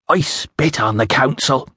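put-on slow, scornful words in a foreign accent; contemptuous Iceni accent. A narrator's reading. Woman rejects the council before the official, scornful Iceni accent. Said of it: "trouble following your accent".

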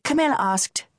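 ordinary delivery

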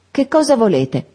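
a touch of timid respect, stress on cosa; timid, respectful. A narrator's reading. The girl asks her cousin timidly, a touch hesitant. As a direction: ask "cosa" gently with a falling close. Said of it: "con timidezza rispettosa".

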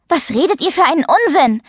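stung, indignant protest; indignant outburst. A narrator's reading. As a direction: snap back at the teasing. Mia jumps up at her tormentors.

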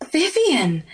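startled high cry of a name; gaping. Acted. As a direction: gasp the name out in shock. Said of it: "gaped Ashley".

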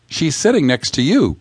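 understated joking comeback; modicum of jest. A narrator's reading. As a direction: tease with a touch of jest. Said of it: "retorts in a modicum of jest".

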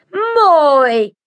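high, slow, theatrical magician voice; mysterious magician. A narrator's reading. Magician Manolo invites the crowd, slow and theatrical. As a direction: lure the crowd in like a showman. Manolo grins before sawing.

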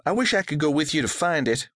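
lightly voiced girl, a bit fast; a hint of a longing daughter; wistful girl. A narrator's reading.

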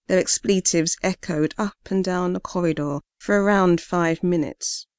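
ordinary delivery, nothing marked

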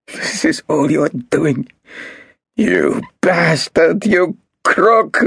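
high, slow, spat out; shrill insults. A narrator's reading. Marcus cursing Golda, shrill and slow. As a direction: drag out each insult.

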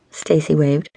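ordinary delivery

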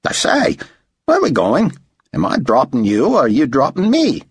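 high, persistent Australian questioning; Aussie accent. A narrator's reading. Aussie driver pestering his passenger, Australian accent. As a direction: nag like an Aussie cabbie. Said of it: "his accent making David guess he was an Aussie".

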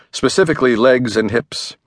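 ordinary delivery

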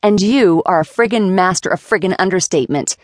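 ordinary delivery

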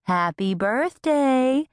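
high, drawn-out greeting rising at the end; lifted, stretched, narrator-voiced call. A narrator's reading. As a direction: call out a birthday wish to someone across the way. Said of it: "Boo called out to us through the door".

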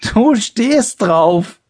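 slow, high, spluttering with laughter; bursting out laughing. A narrator's reading. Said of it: "prustete Thorolf los".

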